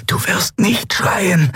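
high, slow, agonised self-command; imploring herself. A narrator's reading. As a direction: hold out through the pain word by word. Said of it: "beschwor sie sich". (gequält beschwörend, hoch und gedehnt)

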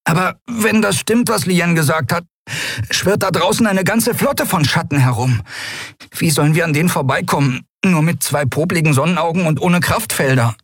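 put-on high boyish voice; worried boy Miko. Miko doubting they can pass the shadows, high worried voice. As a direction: ask anxiously in a high young voice.

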